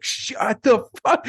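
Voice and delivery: sudden high scream at the heckler. Retold or imitated. Comic retelling how Tim Heidecker screamed at hecklers. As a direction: scream it out to silence the room. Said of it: "He just screamed".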